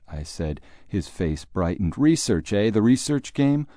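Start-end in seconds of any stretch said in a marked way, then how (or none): 2.0-3.7 higher, louder, keen echo of the word; interested echo. Acted. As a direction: perk up and bounce the word back. Father echoes Jim with interest.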